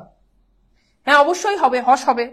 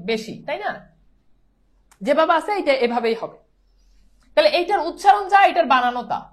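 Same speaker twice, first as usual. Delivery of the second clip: ordinary talk, nothing marked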